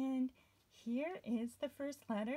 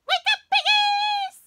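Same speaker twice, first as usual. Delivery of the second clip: much higher, much louder shout; wake-up shout; 14 dB louder than usual. Shown as a demonstration. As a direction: yell to wake the piggies. Song climax wake-up shout.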